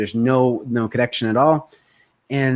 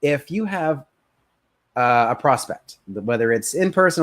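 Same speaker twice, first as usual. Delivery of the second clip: ordinary talk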